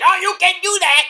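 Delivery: high-pitched imitated scolding; mock indignant, way up high; protesting referee. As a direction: squawk a referee's objection.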